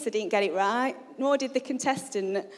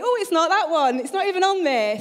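mock dismay; 6 semitones higher, 6 dB louder than usual. Acting out husband's failed quiz guesses.

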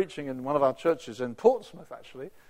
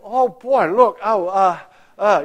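overwhelmed excitement; 5 semitones higher, 13 dB louder, slower than usual. Retold or imitated. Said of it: "I think".

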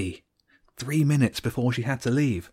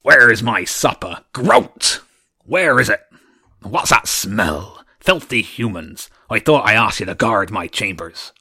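loud booming demand, much louder; booming archduke; 8 dB louder than usual. A narrator's reading. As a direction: boom out the monster's angry demand. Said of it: "a loud, rasping voice boomed out".

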